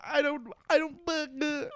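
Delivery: very high mock whimper; hammed-up high pleading; pleading breakdown. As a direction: stammer it like a man falling apart, slowing down. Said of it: "like, pleading, and he's, like, crying".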